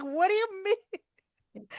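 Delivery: shocked disbelief. Retold or imitated. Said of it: "I yelled at her".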